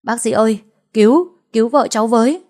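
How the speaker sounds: desperate shout for the doctor; calling out loud. A narrator's reading. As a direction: yell down the corridor for help. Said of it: "chạy ra hành lang gọi to".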